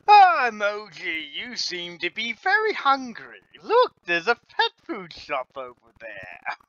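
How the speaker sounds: high, slow showman voice; beast tamer. Acted. Beast tamer cooing to his lion about the pet shop, high and slow. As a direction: coax the lion, high and drawn out.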